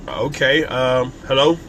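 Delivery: hesitant, low awkward greeting; a lifeless hello to a stranger. Shown as a demonstration. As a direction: mumble a weird unsure hello. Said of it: "They can walk away weird".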